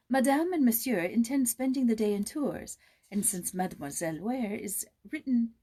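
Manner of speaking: a hint of a French maid; formal maid's message, lightly voiced; a maid announcing plans to Joyce, lightly voiced.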